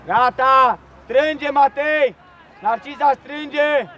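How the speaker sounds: loud, slow drawn-out call across the pitch; coach shout. Real, not acted. Coach shouting to Matei on the pitch, loud and slow. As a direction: bellow it slowly to be heard.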